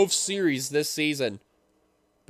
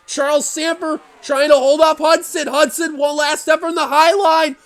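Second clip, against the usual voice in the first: loud, high play-by-play building to the line; excited race call; 9 semitones higher, 7 dB louder than usual. Real, not acted. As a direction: shout the finish like a race caller. Live call of the last lap.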